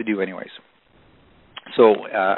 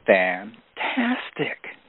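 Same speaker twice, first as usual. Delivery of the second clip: long, rising, savouring fantastic; drawn-out fantastic; 3 dB quieter, much slower than usual. Retold or imitated. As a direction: stretch fantastic very slowly with a rising end. Ziglar hears of eight-hour delay.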